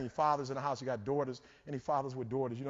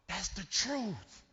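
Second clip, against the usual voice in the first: higher, drawn-out awestruck greeting; excited coach; 7 semitones higher, 4 dB louder, slower than usual. Retold or imitated. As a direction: gush slowly at spotting a celebrity. Voicing an excited coach greeting him.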